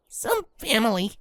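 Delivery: bitter scoff. Acted. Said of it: "Scootaloo scoffed".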